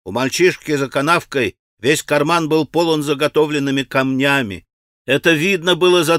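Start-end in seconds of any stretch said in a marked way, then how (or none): none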